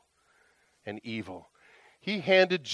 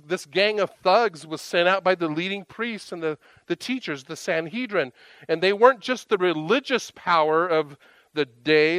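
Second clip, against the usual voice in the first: ordinary talk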